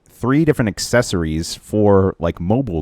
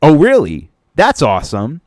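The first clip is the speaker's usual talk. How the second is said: sarcastic; 7 semitones higher, 6 dB louder than usual. Retold or imitated. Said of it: "And I'm like".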